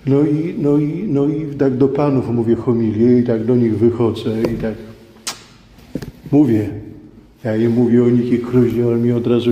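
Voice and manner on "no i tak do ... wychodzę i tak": normal talking voice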